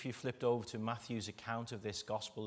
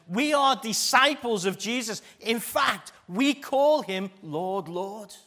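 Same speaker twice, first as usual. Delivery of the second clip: loud mock claim; 8 semitones higher, 11 dB louder, slower than usual. Voicing people claiming discipleship.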